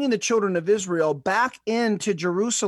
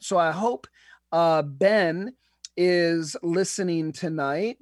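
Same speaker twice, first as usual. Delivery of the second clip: ordinary talk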